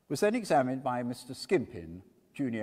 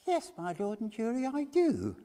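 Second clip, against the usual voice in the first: put-on higher, twittering old lady; eager humble witness voice; high fussy old woman witness; 11 semitones higher than usual. A narrator's reading. Old washerwoman witness answers.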